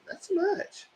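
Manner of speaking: high squeaky mock-shocked "girl, ooh"; playful high squeal of surprise. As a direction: pitch way up in fake disbelief. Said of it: "I said".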